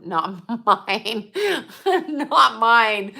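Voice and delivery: laughing through the words. Real, not acted. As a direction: giggle it out. Laughs at her joke.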